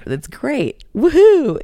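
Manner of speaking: high, loud fake woohoo; mock cheer. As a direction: cheer it sarcastically.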